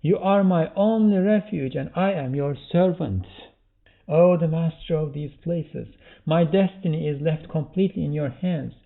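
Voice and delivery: fervent prayer, high and loud; exclaimed supplication. Acted. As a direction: call out devotion to the master. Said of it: "we should all exclaim".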